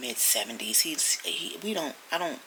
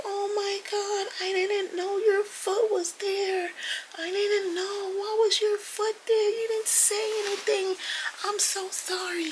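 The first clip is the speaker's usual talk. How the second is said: high, slow panicked apology; frantic daughter; slower than usual. Retold or imitated. Daughter apologizing to her father after hitting his foot, high panic. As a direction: fret like a flustered daughter. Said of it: "she's like".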